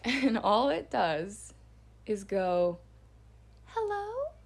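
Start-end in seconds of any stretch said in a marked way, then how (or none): 3.6-4.3 put-on high, eerie little-girl doll voice; creepy doll. Unseen little girl calls from the fence, creepy doll voice. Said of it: "it sounds like a creepy".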